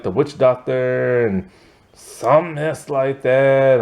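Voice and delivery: normal talking voice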